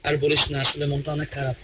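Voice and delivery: acted sadness. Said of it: "আমি একটু acting করে দেখাচ্ছি".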